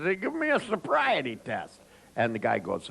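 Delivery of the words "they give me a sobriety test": put-on high, slurred drunk voice; drunk man. Drunk man challenging the security guard, high and slurred. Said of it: "still blasted out of their head".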